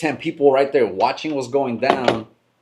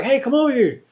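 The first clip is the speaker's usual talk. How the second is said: much higher retold call; casual call; 13 semitones higher than usual. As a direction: relay the call high and light. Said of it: "They were just like".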